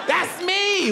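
very high testifying cry; high-pitched mock fan outburst; fervent crowd reply. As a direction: claim it from the crowd. Audience member answering the singer.

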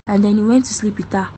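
usual speaking voice

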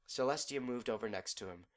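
ordinary delivery, nothing marked

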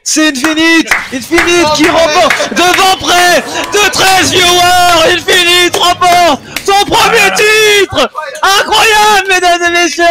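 shouting play-by-play. Acted. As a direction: scream the winner like a sportscaster.

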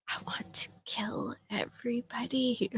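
whisper. Retold or imitated. Quoting herself, overheated.

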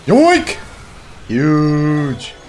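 loud, high whoop, last word drawn out; excited cry. Real, not acted. Gamer celebrating a play mid-match, excited cry.

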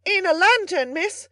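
high, loud call; far-carrying cry. A narrator's reading. Servant adding his bit to the ladies upstairs, carrying shout. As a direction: holler it up through a cupped hand. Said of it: "so that his voice might travel the better".

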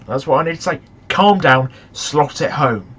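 usual speaking voice

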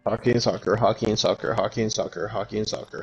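tongue twister fast. Shown as a demonstration. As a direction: rattle off hockey and soccer as fast as you can. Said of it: "five times fast".

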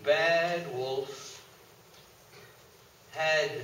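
plain reading voice

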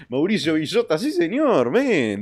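high, loud cheer; excited high-pitched acclaim; hyped shout-out. Real, not acted. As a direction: hail a fan with rising gusto. Cheers a viewer by name.

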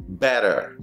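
low "better" as "beh-der"; flapped T. Shown as a demonstration. Teacher giving an example, flapped pronunciation. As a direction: tap the T in "better". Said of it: "sino better".

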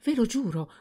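quick high pleading oath; hasty oath. A narrator's reading. Servant swearing to her master, quick high oath. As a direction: blurt the oath. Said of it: "La ragazza rispose precipitosamente".

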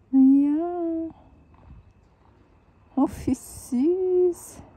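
high, loud, drawn-out coo. Real, not acted. Vlogger meeting the baby kittens, cooing. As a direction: gush slowly over something adorable.

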